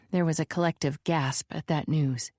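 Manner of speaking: usual speaking voice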